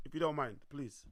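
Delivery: ordinary talk, nothing marked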